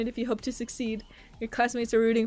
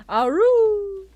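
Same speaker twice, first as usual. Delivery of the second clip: character cry; 10 semitones higher, 10 dB louder than usual. Acted.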